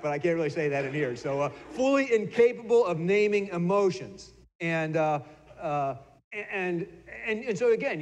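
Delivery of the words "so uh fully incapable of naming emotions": louder, leaning on "fully"; punchy repeat. Shown as a demonstration. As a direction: land the joke again. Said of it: "it's even better with the F".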